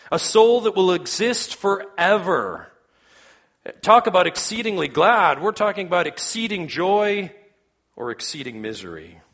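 high, loud pulpit proclamation; impassioned preaching. Real, not acted. Preacher pressing a truth on the congregation, impassioned preaching. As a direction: preach it out with force, pitched high.

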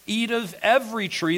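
EVERY TREE pitched high and punched; high, expansive stress on "every tree"; emphasis on abundance. Shown as a demonstration. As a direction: lift and hit "every tree" to show abundance. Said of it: "that's abundance".

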